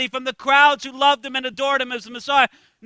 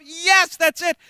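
normal talking voice

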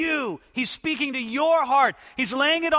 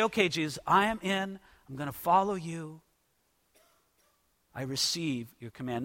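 soft, falling, quieter prayer of yielding; hushed surrender; 6 semitones lower, 7 dB quieter than usual. Pretend. Preacher voicing a believer's hushed yes to Jesus, softly.

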